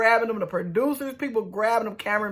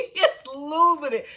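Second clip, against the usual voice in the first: ordinary delivery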